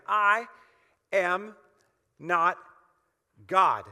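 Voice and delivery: each word spaced and punched loud; I ... am ... not ... God, one word at a time; deliberate word-by-word proclamation; slow emphatic stress. Shown as a demonstration. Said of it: "Can you say that with me?".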